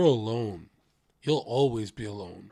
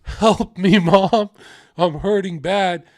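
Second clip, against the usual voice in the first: higher, louder begging cry to mom; desperate plea; 6 semitones higher, 8 dB louder, slower than usual. Retold or imitated. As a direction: beg your mom for help, loud and raw. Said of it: "looking at your mom like".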